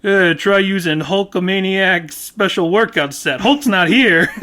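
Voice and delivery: mock wrestler. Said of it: "Mister Wonderful's like".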